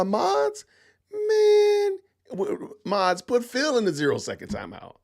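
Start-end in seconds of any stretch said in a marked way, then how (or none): 1.1-2.0 much higher whiny wail; mock cry. Real, not acted. Streamer whining as Phil steals mods, mock cry. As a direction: fake whimper of dismay.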